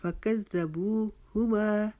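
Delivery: slow model, long बू and quick "hu"; measured recitation. Shown as a demonstration. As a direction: chant फ़कत ज़ा, बू हुमा with held बू. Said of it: "बू को हमने लंबा किया".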